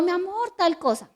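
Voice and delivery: soft, sweet coaxing tone; coaxing sweetly. Retold or imitated. Wife soothing her silent, angry husband, soft coaxing. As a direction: sweet-talk him gently. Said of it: "Y yo".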